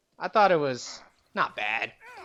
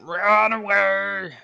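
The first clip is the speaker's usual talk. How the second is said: hammy cry; 8 semitones higher, 9 dB louder, much slower than usual. Pretend. Ordering retreat, hammed.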